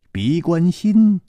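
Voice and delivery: ordinary delivery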